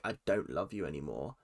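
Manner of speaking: low, softened retold breakup line; understated, dropped-voice quote; mild deadpan. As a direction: deliver it low and quiet, matter-of-fact.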